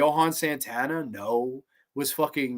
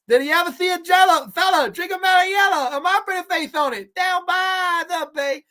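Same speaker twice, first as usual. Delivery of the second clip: much higher, louder put-on dusty singsong; 14 semitones higher, 6 dB louder than usual. Shown as a demonstration. Redoing the camp song for chat in a dusty voice. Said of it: "let me do that in a dusty voice".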